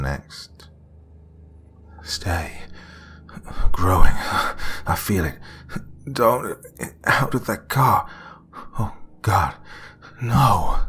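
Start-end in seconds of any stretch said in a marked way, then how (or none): 2.0-10.8 pained cries. A narrator's reading. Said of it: "Through his pain cries".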